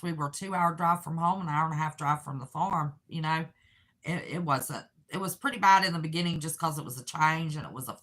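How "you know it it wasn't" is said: ordinary talk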